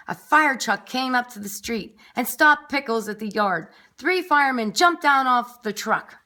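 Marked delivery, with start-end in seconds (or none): none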